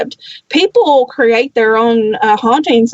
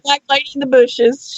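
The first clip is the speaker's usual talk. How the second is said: ordinary talk, nothing marked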